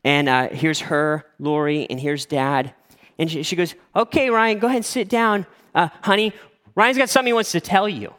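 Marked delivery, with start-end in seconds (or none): 4.0-8.1 imitated mom, pitched well up; chirpy mom. Mother-in-law seating boyfriend before sleepy dad, high and chirpy. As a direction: usher them along in a high mother's voice. Mom voiced, setting up the confession.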